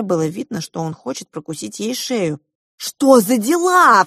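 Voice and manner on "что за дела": loud, high, drawn-out outcry; indignant cry. A narrator's reading. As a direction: cry out in indignation, pitch leaping up. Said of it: "вскричала я".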